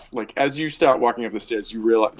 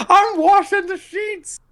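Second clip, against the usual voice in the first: very high, slow screech; exasperated laundry woman; 4 dB louder, slower than usual. Acted. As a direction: screech it out as an indignant washerwoman. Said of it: "this woman comes out of the darkness".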